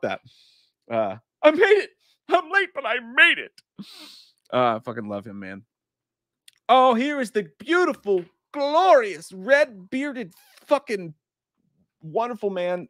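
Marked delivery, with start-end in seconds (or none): 1.4-3.5 high, loud manic impression; over-the-top comic yell; Jim Carrey. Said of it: "Jim Carrey voice". 6.7-12.9 high, swelling showman's build-up; hammed-up gushing tribute; grand fanfare introduction. Real, not acted.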